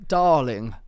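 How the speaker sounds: playful send-up with a falling tune; Charlotte Tilbury. Acted. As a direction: show off a posh celebrity catchphrase. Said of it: "that's an impression of her".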